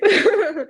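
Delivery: high burst of laughter. Real, not acted. New homeowner laughing about the cost of buying. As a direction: laugh at how pricey it is.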